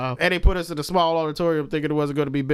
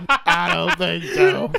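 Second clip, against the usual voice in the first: slow mock drawl; 3 semitones higher, 4 dB louder, much slower than usual. Mock drawled refusal, laughing.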